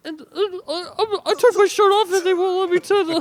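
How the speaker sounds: very high, loud mock Bert voice; high-pitched Bert Kreischer. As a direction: do Tom's squeaky Bert Kreischer bit. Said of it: "Tom's high-pitched Bert Kreischer is the best one".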